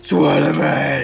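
slow, low imitation of a menacing hero; hammed-up low, drawn-out threat; mock Batman interrogation. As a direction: drag it out low like a vigilante.

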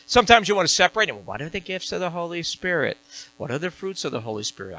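ordinary talk, nothing marked

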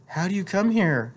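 mildly demanding, pitched well up. A narrator's reading. Apollonia questions Kino, found hiding in her house, mildly demanding. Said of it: "she demanded".

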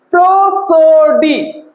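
প্রসোডি drawn out very slowly as pro, so, di; syllable by syllable. Shown as a demonstration. Teacher asking how many syllables the word has, drawn out by syllable. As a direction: stretch each of the three syllables of প্রসোডি. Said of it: "তিনবারে কিন্তু আমরা এই ওয়ার্ডটাকে".

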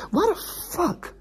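squealed, very high-pitched rant; high squeaky tantrum of disbelief; exasperated outburst. Real, not acted. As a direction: wail it way up high, words stretched out. Exasperated outburst over towing fees.

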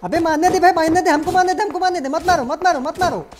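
high, loud, insistent begging; real panicked gamer shouting; frantic shouted plea. Real, not acted. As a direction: yell urgently at teammates to stop. Frantically stopping teammates from killing.

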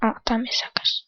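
hushed whisper on spotting something. Real, not acted. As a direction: whisper it as you spot it. Spots a cave quietly.

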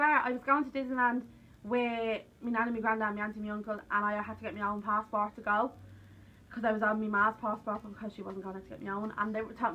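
usual speaking voice

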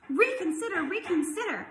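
high, pleading goose cry. A narrator's reading. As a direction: call out urgently, easing slightly on the repeat. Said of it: "cried the goose".

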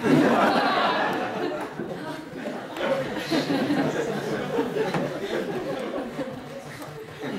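long, loud, breathy laughter; can't stop laughing at the punchline; real laugh. Real, not acted. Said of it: "I have to control myself".

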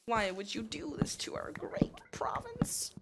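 slow, hushed mock lament; mock lamenting whisper. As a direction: whisper a theatrical reproach, drawn out. Mock lament addressed to England.

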